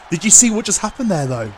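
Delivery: can't believe what just happened; stunned disbelief. Real, not acted. Disbelief after kickout.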